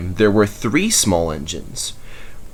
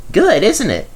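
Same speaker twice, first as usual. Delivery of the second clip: small engine voice; 12 semitones higher, 4 dB louder than usual. A narrator's reading. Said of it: "said a voice".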